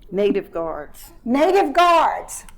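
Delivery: loud, slow, drawn-out calling; bingo caller. Pretend. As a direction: announce each ball to the whole hall. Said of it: "the bingo caller".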